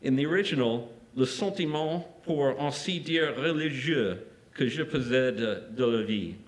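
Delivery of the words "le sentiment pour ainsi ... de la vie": Matisse's French read aloud with an English-speaker's accent; French quote, bad accent. Real, not acted. Lecturer reading Matisse's French original to students, in a plainly English-accented French. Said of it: "Sorry for my bad accent".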